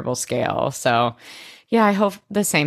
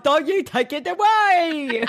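high, slow put-on voice through laughter; mock dramatic begging; Blair pleading; 11 semitones higher, slower than usual. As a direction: laugh while hamming up a plea. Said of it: "She's like".

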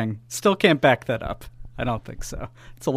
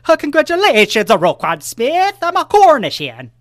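Cornish hen voice; 9 semitones higher, 5 dB louder than usual. Pretend. Signing off the segment as the hen, high and silly. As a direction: squawk a congratulations as the hen.

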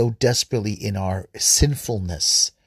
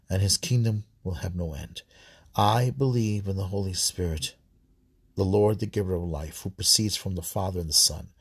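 even, subdued recital of a creed; prayer recitation; 3 semitones lower, 4 dB quieter than usual. Real, not acted. Worshipper leading the Apostles' Creed, prayer recitation. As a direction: recite it steadily as a prayer, softly falling phrases.

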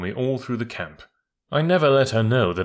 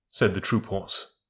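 plain reading voice

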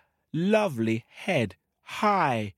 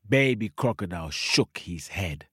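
ordinary delivery

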